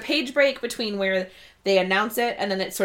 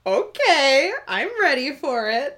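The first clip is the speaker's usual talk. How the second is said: eager reader; 5 semitones higher, 3 dB louder, slower than usual. Retold or imitated. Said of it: "I was like".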